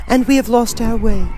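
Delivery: put-on high, fast eager girl; young girl voice. A narrator's reading. Girl asking the monkey king, high eager voice. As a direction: rush it out as the little girl.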